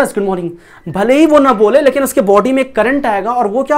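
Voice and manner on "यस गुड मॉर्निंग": ordinary talk, nothing marked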